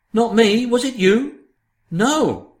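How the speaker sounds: ordinary delivery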